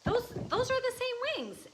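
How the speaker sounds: high, astonished voice; amazed friend. Retold or imitated. Friend seeing the painting, high and amazed. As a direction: gasp it out like a revelation. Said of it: "she looked at me and she said".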